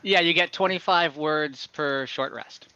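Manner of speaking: normal talking voice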